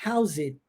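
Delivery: "house it" with the S running straight into the vowel; "house it" said as one linked word; linked sounds. Shown as a demonstration. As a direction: join "house it" into one smooth chunk. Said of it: "eu vou unificar as duas coisas".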